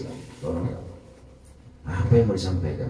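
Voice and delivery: whispered, tearful prayer for help; tearful plea. Retold or imitated. Desperate speaker begging God before his sermon, tearful whisper. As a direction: plead softly through tears. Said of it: "Akhirnya nangis".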